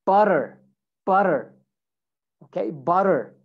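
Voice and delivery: American "butter", tt flapped like D, R kept; American accent. Shown as a demonstration. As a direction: say "butter" like "budder", American style. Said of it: "American accent, you would say".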